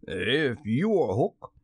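slow, almost humble coaxing; mildly wheedling pirate captain; ingratiating. A narrator's reading. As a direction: coax the stranger gently and slowly. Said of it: "Hook tried a more ingratiating manner".

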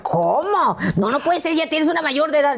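high-pitched mock astonishment; incredulous boyfriend. Voicing boyfriend's disbelief. (incredulidad imitada, voz muy aguda)

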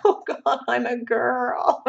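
high, slow dismay with a laugh; dismayed quote. Retold or imitated. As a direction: groan it out in mock horror, laughing. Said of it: "and I was like".

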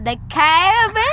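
put-on Southern accent. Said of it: "Southern accent".

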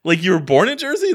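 high, incredulous what-what; baffled disbelief. Retold or imitated. Retelling meeting a Brit, high baffled disbelief. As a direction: act out stunned confusion at the stranger.

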